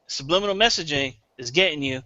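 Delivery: usual speaking voice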